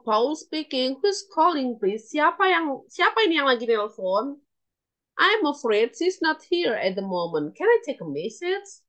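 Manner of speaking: normal talking voice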